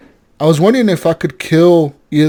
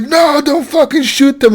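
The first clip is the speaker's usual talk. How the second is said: much higher, slow mock cry; scared plea; 12 semitones higher, slower than usual. Captive begging a gunman to spare his family, imitated scared plea. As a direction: imitate a man pleading in fear. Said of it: "he's scared that I'm gonna shoot them".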